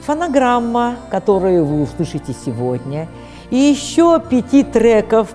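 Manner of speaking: ordinary delivery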